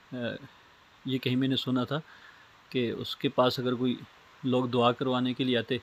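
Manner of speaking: ordinary delivery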